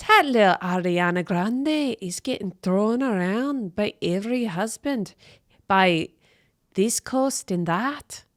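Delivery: playful put-on Scottish accent; mock Scottish accent. Switching to a Scottish accent to mock the singer. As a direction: roll it out in a jokey Scottish accent. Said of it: "a Scottish accent".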